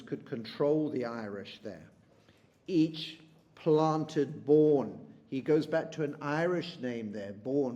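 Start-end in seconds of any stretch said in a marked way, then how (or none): 2.7-5.0 very slow and louder, each word weighed; poem reading. Shown as a demonstration. Teacher reading the verse aloud, dwelling on each word. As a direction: lean into Bourne and let it fall. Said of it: "quoting the poem's lines".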